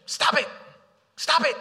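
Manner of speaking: high, loud nagging stop it; a high, loud mock scolding. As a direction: snap it out like a fed-up scold. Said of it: "I'm not just saying".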